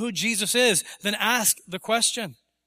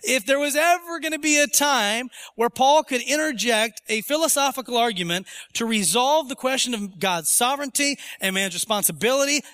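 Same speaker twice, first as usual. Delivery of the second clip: usual speaking voice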